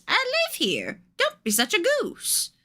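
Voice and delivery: a hint of a scolding granny; grandma voice. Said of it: "your grandmother answers".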